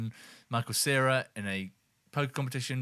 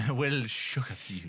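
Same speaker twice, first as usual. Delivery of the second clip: clickbait video narrator; much slower than usual. Acted. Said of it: "echoing the clickbait line".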